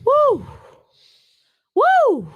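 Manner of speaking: high whooping cheer; excited woo. Real, not acted.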